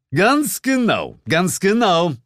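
high, loud, savoring each agreement; smug boss. Acted. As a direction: deliver a homily to a subordinate. Boss's smug homily.

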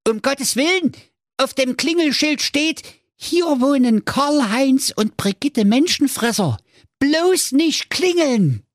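put-on high, panicky kids crying out; children begging him, slowing at the end; frightened children.

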